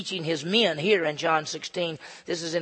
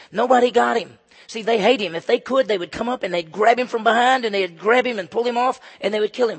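loud, higher preaching burst; forceful preaching; 4 semitones higher, 5 dB louder than usual. Acted. Pastor stirring the congregation, loud and intense. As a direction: drive it home to the congregation.

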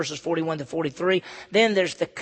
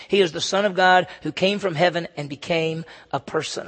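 usual speaking voice

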